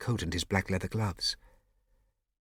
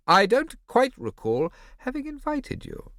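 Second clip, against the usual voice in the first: cool ironic lady; 7 dB louder than usual. Acted. Ida's voice, stern ironic.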